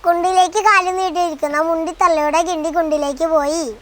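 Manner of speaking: rattled off fast in one breath; fast tongue twister. Shown as a demonstration. Child rattling off a tongue twister for the parent, fast. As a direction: race through the tongue twister at speed. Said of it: "ഒന്നുകൂടി പറഞ്ഞേ".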